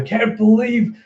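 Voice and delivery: high mock-tearful outburst; hammed-up crying complaint; crying disbelief. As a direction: wail it in exaggerated hurt. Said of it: "[name]'s just crying with his exaggerated".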